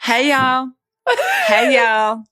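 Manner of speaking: high, twangy Southern "Hey, y'all"; Southern American accent. Retold or imitated. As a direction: drawl it like a Southern golfer. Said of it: "Do, do your impression right now".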